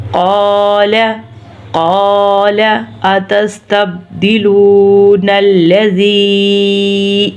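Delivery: slow, long "قا" in "قال"; elongated recitation. Shown as a demonstration. Teacher showing three long letters, elongated recitation. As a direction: hold "لو" and "ذی" in "اتستبدلون الذی". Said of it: "کیوں لمبے کیے ہیں؟".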